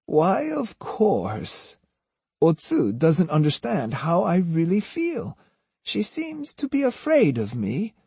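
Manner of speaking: high, softened granny voice feigning innocence; wheedling old woman. A narrator's reading. Osugi assures captive Jotaro she means well, wheedling.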